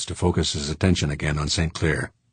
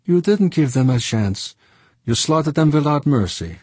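higher, louder French voice, wounded; reproachful Frenchman; 9 semitones higher, 4 dB louder than usual. Acted. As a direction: reproach an old friend for killed friends. Saint Clair accusing Fuchs.

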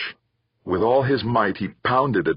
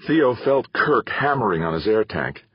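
ordinary delivery

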